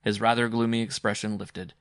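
ordinary delivery

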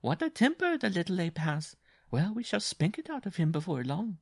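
high-pitched mocking bride voice; domineering bride. A narrator's reading. Bride to her struggling little husband, high and mocking. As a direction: scold him from way up high.

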